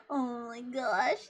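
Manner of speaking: ordinary talk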